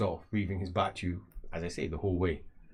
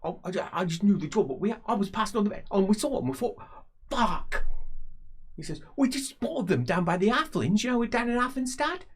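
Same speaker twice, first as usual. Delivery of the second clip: much higher, fast babbling excuses; panicked high voice; 13 semitones higher than usual. Acted. Berthold panicking, excusing himself.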